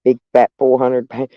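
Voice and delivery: ordinary talk, nothing marked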